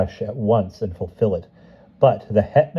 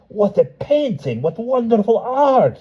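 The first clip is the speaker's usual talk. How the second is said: much higher, slow, wide-eyed wonder; awed blacksmith; 3 dB louder than usual. Acted. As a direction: breathe it out in amazement. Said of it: "he thought".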